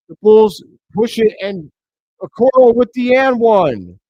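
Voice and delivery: high, loud fast-break call; excited yell. Real, not acted. Commentator calling an and-one, high and loud. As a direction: call it like the crowd's on its feet.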